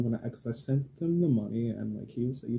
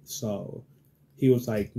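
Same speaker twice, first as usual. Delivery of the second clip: ordinary talk, nothing marked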